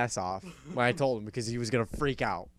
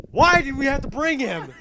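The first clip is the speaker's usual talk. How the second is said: high, loud complaint; mock exasperated yell; 11 semitones higher, 6 dB louder than usual. Jeff after Fat Man bolts for basement, exasperated shout. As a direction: imitate a fed-up friend wailing the question.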